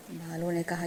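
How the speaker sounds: ordinary delivery, nothing marked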